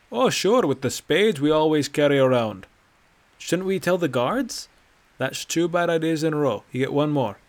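put-on loud, scoffing old man voice; mock the idea with heavy sarcasm; sarcastic. Bracknell mocks the ideas.

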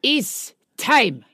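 put-on slow, portentous German accent; comic German accent, each word separate. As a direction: announce it slowly in a German accent. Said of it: "In, in his German accent".

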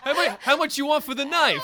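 eager street haggler, pushing for the knife; haggling vendor. Pretend. Vendor bargaining for Jason's knife, eager high haggling.

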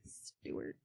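whispered aside. Real, not acted. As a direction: breathe the name as a whisper.

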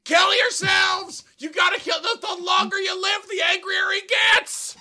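doomsday hog wail. Acted. Dying hog warning everyone of God, shrieking wail. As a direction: preach doom in a shrieking wail.

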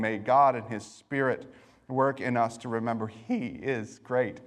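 ordinary talk

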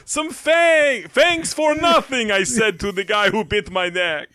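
high, loud, slow vampire Santa. Pretend. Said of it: "vampire Dracusanta".

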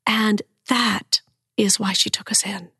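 moved, unvoiced whisper. Real, not acted. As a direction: breathe it out, moved by the truth. Emotional revelation of refuge.

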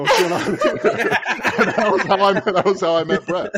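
cracking up at the memory; laughing. Real, not acted. Finishing the story of meeting Brett, cracking up.

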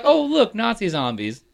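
high-pitched retold reaction, dropping at the end; high mock-surprised exclamation; mock surprise. Retells own reaction to zombies appearing.

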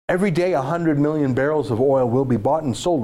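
normal talking voice

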